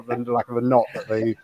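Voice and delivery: ordinary delivery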